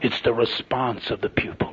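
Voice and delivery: slow, low, near-whisper; hushed. Real, not acted. Preacher repeating the lesson to a brother, hushed. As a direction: sink into a slow hush to let it land.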